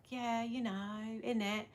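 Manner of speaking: soft mock-British chat; quiet playful British lilt; British accent. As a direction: casually imitate British slang in accent. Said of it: "we'll walk around and be like".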